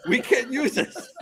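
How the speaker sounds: high, slow, dismayed imitation through laughter; Gideon. Filmmaker objecting to ruined footage, high mock dismay. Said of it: "Gideon was like".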